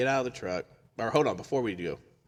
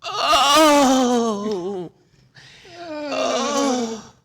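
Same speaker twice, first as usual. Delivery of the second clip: long, high, loud wailing "oh"; hammed-up drawn-out howl; imitated wail; 14 dB louder than usual. Storyteller imitates the noise heard from the chapel, wailing loudly. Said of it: "we hear this".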